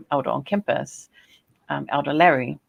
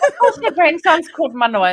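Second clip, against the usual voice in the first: high, loud amused retelling; amused exclamation; 12 semitones higher, 9 dB louder than usual. Retold or imitated. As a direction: say it as an obvious joke, up high. Retelling a comic encounter.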